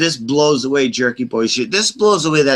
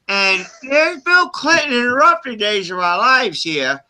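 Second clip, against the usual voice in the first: mock prank caller; 8 semitones higher than usual. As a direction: ham up the prank caller demanding Clinton.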